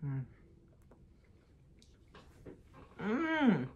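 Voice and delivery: humming while tasting food; savoring a bite, lips closed; mouth full of ice cream. Real, not acted. Seen 0: takes bite at -2, closed-mouth tasting, mm sounds until 5.7.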